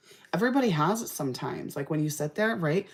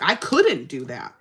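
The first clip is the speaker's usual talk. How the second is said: normal talking voice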